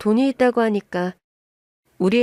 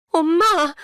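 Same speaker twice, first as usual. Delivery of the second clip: high, startled cry to Mom; startled child; 7 semitones higher, much slower than usual. A narrator's reading. Daughter startled by her mother's shouting, high startled cry. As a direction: exclaim in alarm, pitched up. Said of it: "애가 놀라서".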